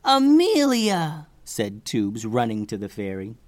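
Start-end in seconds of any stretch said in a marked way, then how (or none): 0.0-1.3 high, drawn-out delighted call; joyful cry. Acted. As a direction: greet a long-lost friend, stretching the name. Joyful reunion with the fairy.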